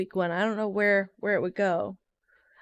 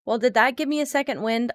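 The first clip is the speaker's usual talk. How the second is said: normal talking voice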